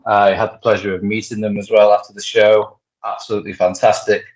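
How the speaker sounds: ordinary talk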